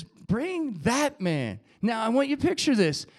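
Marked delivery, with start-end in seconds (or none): 0.0-1.6 very slow, deliberate command. Acted. Jesus stopping to summon the beggar, slow command. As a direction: stretch out each word to single him out.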